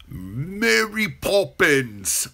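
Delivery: high, loud, drawn-out mock British accent; stretched, over-the-top bad British accent; awful British accent. Shown as a demonstration. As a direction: belt out a hammed-up, botched British accent. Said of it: "Americans doing British accents go either".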